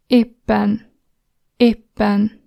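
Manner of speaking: "Éppen" with the pp held long; long double p. Shown as a demonstration. Teacher modelling a Hungarian double consonant, held. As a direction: hold the pp in "Éppen" before release. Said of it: "the double version is like this".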